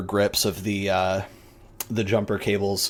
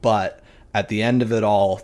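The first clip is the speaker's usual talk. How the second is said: normal talking voice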